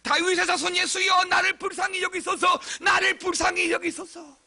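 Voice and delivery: high, insistent pleading shout; loud crying out. Retold or imitated. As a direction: blind beggar calling to someone he cannot see. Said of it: "큰 소리를 지르는 거예요". (높은 목소리로 간절하게 부르짖음)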